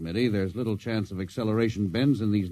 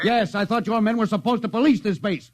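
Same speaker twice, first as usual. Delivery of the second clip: angry outburst; 10 semitones higher than usual. Acted. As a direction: lash out at ground control. Captain angry at ground control.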